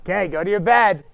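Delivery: loud, low order to bed; firm command. Real, not acted. Host ordering contestants to bed, loud and firm. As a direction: send them off to bed firmly.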